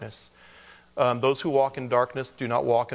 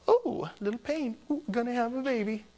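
mock blissful wife; 11 semitones higher, 3 dB quieter than usual. Husband imitating his wife in early labor, higher and cooing. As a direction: coo it like a woman not yet feeling the pain. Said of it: "She goes".

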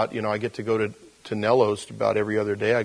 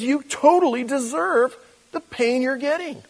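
mock condemnation; 13 semitones higher than usual. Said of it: "God never looks at you and me and says".

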